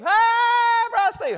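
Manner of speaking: put-on a high, loud, drawn-out kid's greeting. A teen greets the preacher, high and loud. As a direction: sing out the greeting high like an eager kid.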